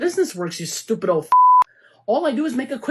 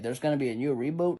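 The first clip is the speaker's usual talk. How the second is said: ordinary delivery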